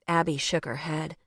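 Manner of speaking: ordinary delivery, nothing marked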